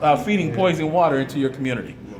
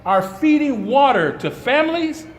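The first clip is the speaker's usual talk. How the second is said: impassioned denunciation; 6 semitones higher than usual. Real, not acted. Activist condemns officials over poisoned water at press conference, impassioned outrage. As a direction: denounce them in a high, raised voice, pausing for weight.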